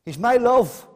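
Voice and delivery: loud and high, ringing out the verse; proclaimed. Acted. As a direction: call it out to the whole church. Quoting the bride, then echoing.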